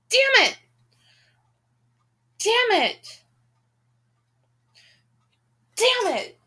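high, drawn-out swearing; exasperated curse. Real, not acted. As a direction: curse it under the frustration. Reader cursing the book.

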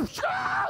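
high explosive splash sound; hammed-up high-pitched gush; gushing water noise. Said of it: "It was like".

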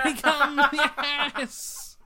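high, laughing entrance call; mock hype. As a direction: pretend to hype a wrestler's run-in, high-pitched.

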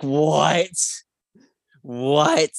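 ordinary delivery

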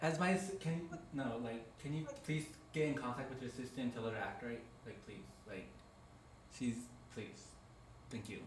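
quiet urgent phone plea; hushed. Real, not acted. Hushed plea to assistant on the phone.

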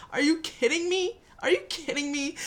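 high, outraged disbelief; incredulous. Real, not acted. Vlogger reacting to R. Kelly's outburst, incredulous.

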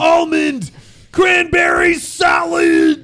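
high, slow hammed-up yell; high mock shout. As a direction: squeal it out like a silly ad. Shouting Wendy's menu items game.

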